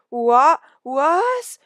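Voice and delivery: high startled squawk, loud and broken; jolted out of surprise; startled cat yelp; astonished yelp. Acted. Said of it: "recognized the astonished voice".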